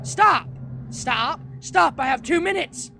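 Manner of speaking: panicked real yelling of stop; frantic shouting. Real, not acted. Begging monster to stop.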